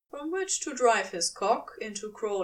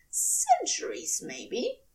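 high whispered tease; angel teasing; 12 semitones higher, slower than usual. A narrator's reading. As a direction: toss it off playfully. Aziraphale voiced high.

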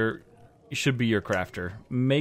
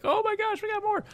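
much higher, gushing fake delight; mock excitement. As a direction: squeal it like a thrilled fan. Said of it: "which is kinda like".

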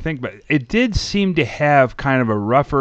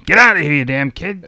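gruff scolding; 4 semitones higher, 4 dB louder than usual. Retold or imitated. Acting out a director scolding kids.

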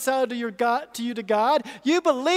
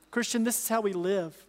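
ordinary talk

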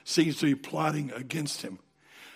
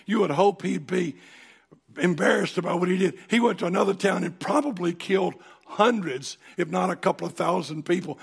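ordinary talk

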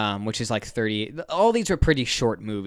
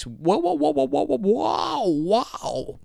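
sound effect; 14 semitones higher, much slower than usual. Pretend. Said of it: "Too much".

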